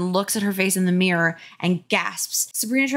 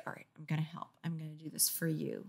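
soft-spoken; 3 semitones lower, 16 dB quieter, faster than usual. Acted. Quoted Sabrina, very soft.

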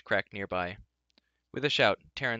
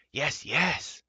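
higher, eager creature yelp; excited creature exclamation; Gurgi crying out; 5 semitones higher, 4 dB louder than usual. A narrator's reading. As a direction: cry out hungrily as a little creature. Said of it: "Gurgi cried".

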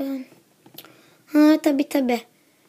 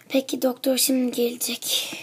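soft voiceless whisper; 2 semitones lower, 5 dB quieter than usual. Acted. Mother whispering by the sick girl's bed that the doctor is coming. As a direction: whisper so the sick child can rest.

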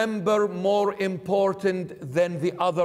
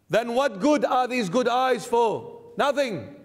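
ordinary talk, nothing marked

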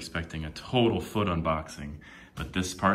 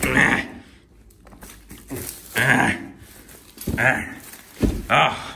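loud straining ahs; effort grunts; 4 semitones higher, 7 dB louder, much slower than usual. Real, not acted. Straining to open the box with his feet, loud grunts. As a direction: push hard and let it out.

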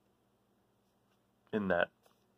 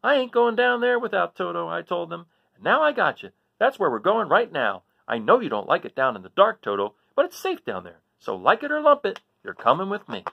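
voicing Dorothy; 9 semitones higher, 6 dB louder than usual. Dorothy scolds Toto before cellar.

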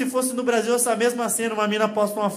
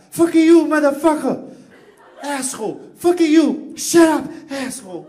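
much higher, louder English swearing; mock American cursing; 8 semitones higher, 8 dB louder, slower than usual. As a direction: bark it like an angry American. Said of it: "querendo xingar". (xingando em inglês, gritando)

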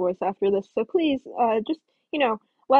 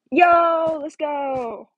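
loud, high hype shout; excited cheer; 5 semitones higher, 5 dB louder, much slower than usual. Real, not acted. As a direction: celebrate the jump. Cheering a parkour success.

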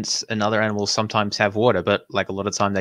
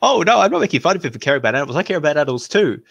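fast, higher, hammed-up mimic of the host; mocking imitation; 5 semitones higher than usual. Viewer mocks the host's claim about his son, fast mocking mimicry. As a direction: rattle it off in a silly mocking voice. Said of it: "It's like".

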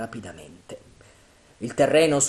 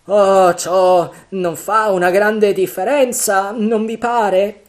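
high, loud, slow pleading; frightened sailor arguing it changes nothing; worried Dick; 9 semitones higher, 12 dB louder, slower than usual. Acted. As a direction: press the point anxiously. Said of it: "intervenne Dick".

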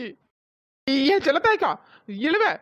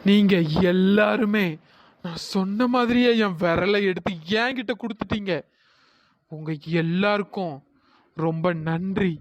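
solemn ghost woman; 7 semitones lower than usual. Acted. Ghost woman thanks those who found her finger, solemnly. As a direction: give grave thanks, low and unhurried.

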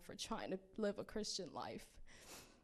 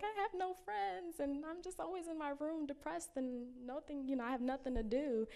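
imitating crying; 8 semitones higher than usual. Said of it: "crying on the phone with him".

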